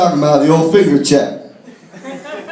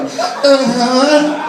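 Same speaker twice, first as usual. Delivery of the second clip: higher, choked little uh-huh; strained neck-spasm grunt; 6 semitones higher than usual. Retold or imitated. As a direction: squeeze it out through a locked neck. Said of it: "in a fucking neck spasm going".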